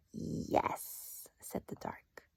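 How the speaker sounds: hushed, breathy storybook whisper; whispering the dark's words to first graders; spooky whisper. Acted. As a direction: whisper it like a secret. Narrator whispers the dark.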